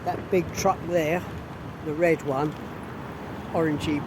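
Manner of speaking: normal talking voice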